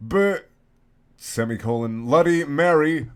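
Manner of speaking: ordinary delivery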